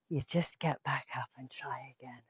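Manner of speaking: soft unvoiced whisper; whispered reassurance. Teacher reassuring kids who fall over, whispering. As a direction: whisper it gently to comfort.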